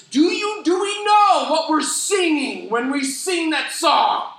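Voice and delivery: high, loud, slow intense plea; excited urgent question. Retold or imitated. Sue grabbing his shoulder after service, urgent and excited. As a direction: grab them and ask urgently. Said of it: "she says".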